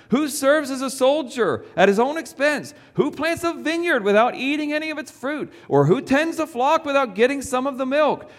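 high, ringing preacher voice reading Paul; fervent scripture reading. Acted. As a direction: proclaim the verses like Paul defending himself. Reads Paul's letter aloud.

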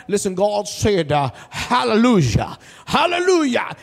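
mock preacher, slow then climbing up on hallelujah; imitating a fiery preacher. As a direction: ham up the street preacher. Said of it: "He's like".

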